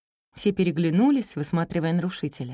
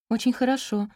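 higher, uncertain approval; bewildered; 6 semitones higher than usual. A narrator's reading. Teacher thrown by a mother's answer, bewildered. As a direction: say it at a loss. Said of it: "растерянно проговорила учительница".

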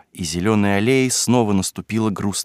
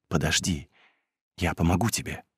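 hushed and low-key, much softer; muffled voice; 4 semitones lower, 9 dB quieter than usual. A narrator's reading. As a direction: keep it down so nobody hears. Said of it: "стараясь заглушить звук своего голоса". (приглушённым голосом)

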